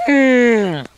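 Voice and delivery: high, loud hum like a calling horse; horse mating call. Shown as a demonstration. Kid showing his horse mating call, high loud hum. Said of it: "that's a horse mating call".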